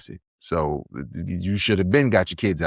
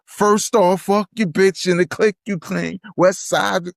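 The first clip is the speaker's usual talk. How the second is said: reciting lyrics; 9 semitones higher than usual. Retold or imitated. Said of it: "All I heard was".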